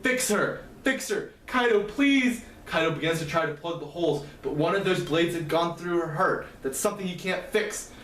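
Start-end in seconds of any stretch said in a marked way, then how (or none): 0.0-2.4 high, desperate plea at moderate volume; pleading scream. A narrator's reading. Izumi screams at Kaido to fix her, desperate high plea. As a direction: beg with the pitch climbing to the last word. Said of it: "he screams at Kaido".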